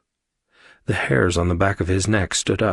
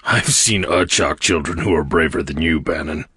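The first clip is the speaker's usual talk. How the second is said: 1 Karg's voice; 3 semitones lower than usual. A narrator's reading. Karg teasing, monster voice.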